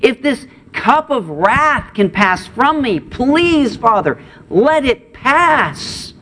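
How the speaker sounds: high, slow, pleading prayer voice; Jesus in anguish. Retold or imitated. Jesus begging his Father to spare him, high slow plea. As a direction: plead slowly up high, as if barely able to bear it.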